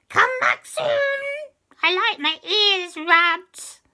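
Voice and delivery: very high, slow, loud witchy bargain; sly and drawn out, bargaining; high cat witch. Acted. Cat witch demanding a favour from the children, high and sly.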